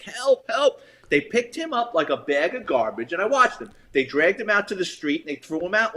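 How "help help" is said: high, weak mock cry for help; feeble plea for help. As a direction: voice the hurt man faintly begging. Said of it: "The guy's like".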